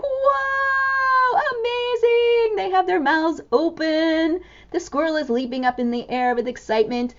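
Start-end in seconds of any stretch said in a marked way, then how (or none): 0.0-2.6 high, drawn-out amazed cry; amazed animals. Acted. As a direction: gasp it out like astonished animals. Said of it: "all the animals around are like".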